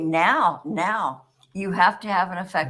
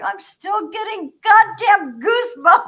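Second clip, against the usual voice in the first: much higher, louder, stunned; emotional outburst; 14 semitones higher, 7 dB louder than usual. Real, not acted. Telling her mother's fetch story, high and loud. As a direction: blurt it out overwhelmed. Overcome retelling a ghost story.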